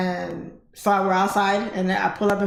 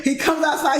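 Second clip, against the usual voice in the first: usual speaking voice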